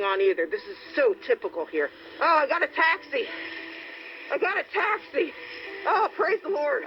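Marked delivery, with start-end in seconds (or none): 2.2-5.3 excited. Real, not acted. Finally gets a taxi, relieved. 5.9-6.8 relieved. Real, not acted. Relief at getting a taxi.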